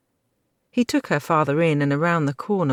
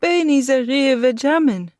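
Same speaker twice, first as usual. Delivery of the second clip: pleading; 11 semitones higher, 8 dB louder than usual. A narrator's reading. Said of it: "she pleaded".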